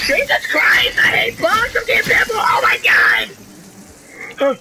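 high, loud shrieking in real fright; panicked scream. Real, not acted. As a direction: yell for your life. Bugs keep attacking.

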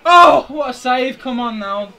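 high, loud, drawn-out exclamation; loud disbelief. Real, not acted. Gamer seeing his shot saved, loud disbelief. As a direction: cry out at the near miss.

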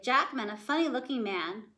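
usual speaking voice

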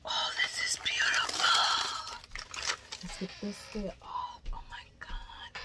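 breathy hushed awe over the yarn; awed whisper. Real, not acted. Unboxing a gift, whispering in awe. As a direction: whisper it like you can't believe it.

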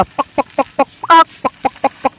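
mock chicken clucks "पक पक"; lightly voiced hen going "पक, पक"; hen clucking. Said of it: "बोल भी रहा है".

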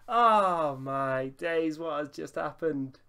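very slow, stunned exclamation; dismayed disbelief. Real, not acted. After conceding a goal, slow stunned disbelief. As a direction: draw out the shock at the goal.

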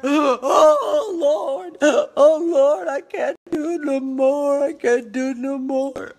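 hammed-up loud groaning plea to the Lord; mock wailing. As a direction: wail it like a melodramatic lament. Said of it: "lay on the floor".

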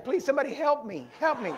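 high, loud comic pleading; mock plea for help. Woman under the preacher's hands, mock pleading. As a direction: imitate a woman begging to be rescued. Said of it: "like she was saying".

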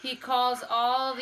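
usual speaking voice

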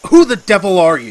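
high, loud demanding shout; alarmed shout. Acted. Man demanding the stranger say who she is, shouting. Confronting the witch.

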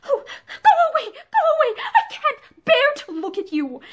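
high, loud cry of despair that slows; anguished outcry. A narrator's reading. Pansy cries out to her husband in anguish, high and loud. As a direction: fling it away in distress.

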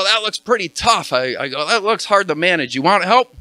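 friendly shouted offer across the yard; calling out. Retold or imitated. Jogger calling out to old man trimming hedge, loud and friendly. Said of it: "And I yell out".

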